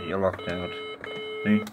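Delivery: usual speaking voice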